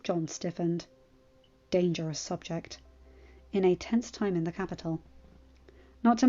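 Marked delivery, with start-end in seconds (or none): none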